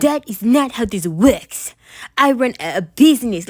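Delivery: a stern Russian psychic, lightly put on; Russian accent. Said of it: "started talking with an accent out of nowhere".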